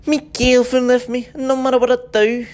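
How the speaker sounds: a heartbroken Scouse accent; a moaning Liverpool accent. Shown as a demonstration.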